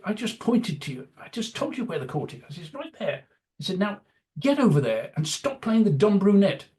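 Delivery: mild mock temper at a flustered reporter; losing my temper. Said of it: "this was me losing my temper".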